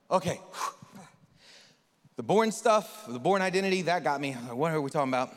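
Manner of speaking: loud, baffled Nicodemus with a sigh; confused Nick. Retold or imitated. Nick reacting to Jesus, loudly baffled. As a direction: play him lost and overwhelmed. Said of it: "you're like".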